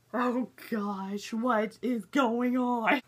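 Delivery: slow, loud mock horror; hammed-up wail of bewilderment; dismayed outburst. Acting out her horrified reaction.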